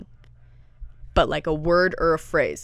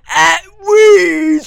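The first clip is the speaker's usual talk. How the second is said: shouted, high-pitched British caricature; British accent; 12 semitones higher, 14 dB louder than usual. Retold or imitated. Imitating the British videos, high shouted British accent. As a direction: yell it as an unintelligible British impression. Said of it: "Like the British ones".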